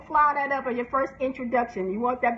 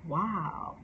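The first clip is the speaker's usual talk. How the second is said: normal talking voice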